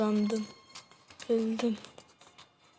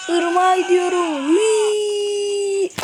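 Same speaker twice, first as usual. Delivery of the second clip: wailing whee; 8 semitones higher, slower than usual. Real, not acted. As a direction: howl it like a ride gone wrong. Flying off toward the river.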